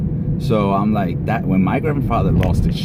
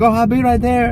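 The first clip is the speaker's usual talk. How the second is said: a hint of an aunt answering brightly, much higher; mock cheerful reply; 3 dB louder than usual. Aunt answering the dead grandfather's call, lightly voiced high reply. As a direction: toss back a quick, high, easy answer. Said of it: "And she's like".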